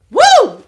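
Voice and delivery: high, loud woo; excited whoop. Real, not acted. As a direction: whoop with joy. Cheering the love news.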